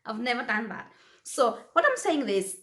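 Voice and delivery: normal talking voice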